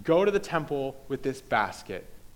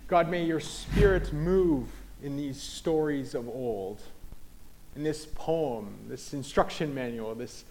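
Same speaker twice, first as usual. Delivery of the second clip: prayer; 2 semitones lower, 3 dB quieter than usual. Real, not acted. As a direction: pray unhurriedly, easing down towards the amen. Leads a prayer.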